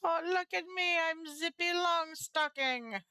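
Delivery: playful show-off voice. Acted. Showing off on zip line.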